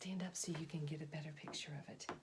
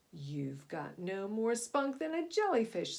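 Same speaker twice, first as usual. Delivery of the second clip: loud mocking sneer; 4 semitones higher, 9 dB louder than usual. Shark taunting Cyrus, loud sneer. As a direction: look down on him and jeer. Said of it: "sneered the shark".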